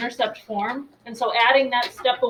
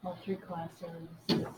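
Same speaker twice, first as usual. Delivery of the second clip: muttering to herself; 4 semitones lower, 11 dB quieter, much slower than usual. Real, not acted. Muttering while posting online.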